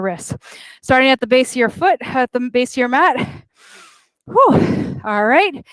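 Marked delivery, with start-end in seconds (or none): 4.3-5.0 relieved exhale. Real, not acted. Exhale after floor transition.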